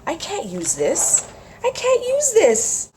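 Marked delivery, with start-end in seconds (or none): 1.6-2.9 much higher, louder, slow squeal. Real, not acted. Vlogger gushing over rice paper, high squeal. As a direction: squeal it in delight.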